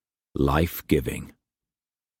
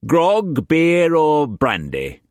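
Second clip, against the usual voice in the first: put-on much higher, hammy old sailor voice; old sea-dog Brock; 10 semitones higher, 4 dB louder, slower than usual. Brock welcoming Struan to his table, hammed-up old sailor. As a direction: boom it out like a rough old trader.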